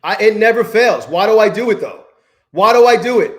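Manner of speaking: fed-up outburst; exasperated self-blame. Real, not acted. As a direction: yell at yourself for doing it again. Blaming himself after the loss.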